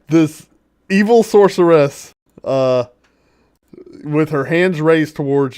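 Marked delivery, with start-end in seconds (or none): none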